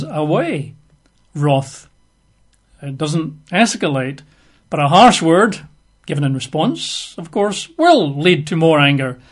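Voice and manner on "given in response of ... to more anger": ordinary talk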